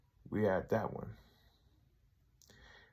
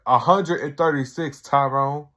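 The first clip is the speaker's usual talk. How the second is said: louder, higher retold correction; mock retort; 4 semitones higher, 8 dB louder than usual. Harold correcting Tyrone about complaints, louder mock retort. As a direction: correct him pointedly, hammed up. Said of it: "he was like".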